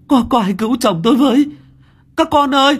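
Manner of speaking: high, loud, fast cries for help; desperate cry for help. Acted. Wife screaming for her children to save her husband, desperate. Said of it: "Tiếng kêu thống thiết của bà".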